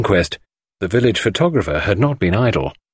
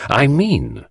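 higher-pitched, firm lead-in; deliberate; 6 semitones higher than usual. A narrator's reading. Poirot spells it out for the suspect, deliberately. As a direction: begin the accusation deliberately. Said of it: "said Poirot deliberately".

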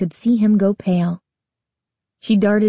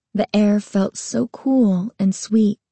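usual speaking voice

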